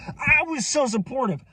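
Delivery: sudden high, squeaky insistence; high-pitched exclamation. Real, not acted.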